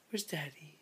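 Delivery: soft peekaboo whisper. Acted. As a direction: hush it like a secret game. Peekaboo with baby, hushed.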